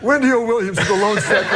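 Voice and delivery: high, giggling retelling; can barely hold the laugh; laughing through a quote. Real, not acted. Host quoting Super Dave's football question, laughing.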